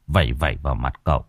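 plain reading voice

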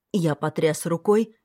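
ordinary delivery

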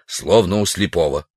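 ordinary delivery

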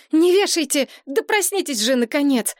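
high-pitched, exasperated urgency; urgent plea. Acted. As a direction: plead hard before they hang up. Desperate plea over the phone.

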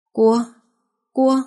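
put-on a husband waking, calling his child; tender call. A narrator's reading. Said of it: "Tiếng gọi thân thương".

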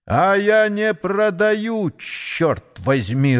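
slow, emphatic refusal, pitched high at first; angry. A narrator's reading. Sportsman refuses the Englishman's bid, getting angry. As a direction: refuse crossly, drawing out each word. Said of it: "рассердился спортсмен".

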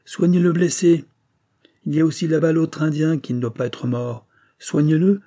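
a victor giving loud orders; stern command. A narrator's reading. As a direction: command them from the bank. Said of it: "il leur commanda".

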